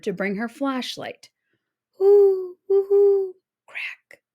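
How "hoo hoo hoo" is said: high loud hooting owl call. A narrator's reading. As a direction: hoot like an owl in the dark. Owl noise in the forest.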